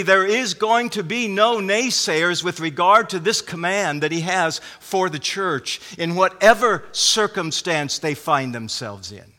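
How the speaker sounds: ordinary talk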